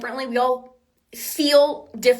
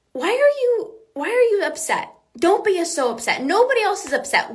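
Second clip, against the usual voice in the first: high-pitched, exasperated reproach; put-on incredulous nagging, pitched well up; mock scolding; 8 semitones higher than usual. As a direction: imitate someone fed up with another's feelings. Imitates people scolding her for feelings.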